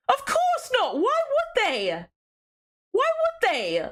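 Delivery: high exasperated disbelief; incredulous. Real, not acted. Streamer mocking a chat question, high incredulous. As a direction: squeak out the obvious answer.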